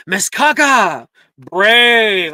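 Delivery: loud, slow teacher call. Acted. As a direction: summon the student with gusto. Teacher calling next student.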